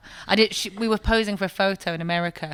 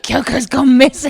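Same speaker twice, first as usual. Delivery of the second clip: mock alarm; 6 semitones higher, 12 dB louder than usual. Bandmates alarmed, relayed quote.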